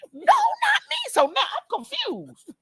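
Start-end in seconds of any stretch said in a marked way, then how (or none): 0.1-1.0 squeaky high laughing denial; innocent kid. Retold or imitated. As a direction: pitch way up, mock child protesting. Boy denying, acted innocent.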